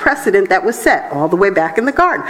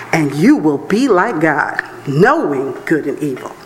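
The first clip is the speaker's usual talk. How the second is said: serpent voice; 6 semitones lower than usual. Acted. Preacher voicing the serpent.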